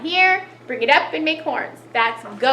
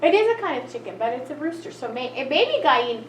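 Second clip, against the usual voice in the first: ordinary talk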